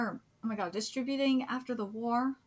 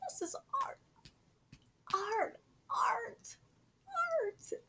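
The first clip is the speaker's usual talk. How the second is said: awed whisper; 11 semitones higher, 4 dB quieter, much slower than usual. Real, not acted. Awed repeated whisper of art.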